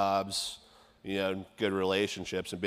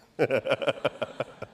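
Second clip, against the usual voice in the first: higher-pitched burst of real laughter; hearty chuckle; 5 semitones higher than usual. Real, not acted. Speaker laughs at his joke about being late for the big man, amused laughter. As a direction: laugh openly at your own joke.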